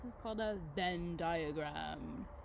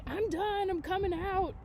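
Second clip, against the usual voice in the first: much higher, bright caterpillar voice bursting out; excited butterfly; 10 semitones higher, 5 dB louder than usual. Retold or imitated. Teacher voicing the caterpillar from yesterday's book, high and eager. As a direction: play the caterpillar announcing he's ready. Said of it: "when he's like".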